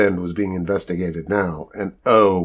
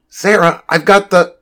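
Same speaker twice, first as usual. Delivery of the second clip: high, raised and cut short; urgent interjection; 9 semitones higher, 5 dB louder than usual. A narrator's reading. Rachel tries her best to interrupt Sarah, urgently. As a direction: push in urgently, then break off.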